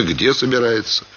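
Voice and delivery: ordinary delivery, nothing marked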